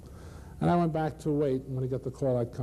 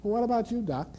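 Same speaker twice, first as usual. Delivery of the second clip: higher, teasing nurse voice; smart aleck nurse; 10 semitones higher than usual. Retold or imitated. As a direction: needle the doctor, cheeky and high. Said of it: "the smart aleck nurse said".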